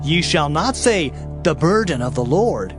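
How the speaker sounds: usual speaking voice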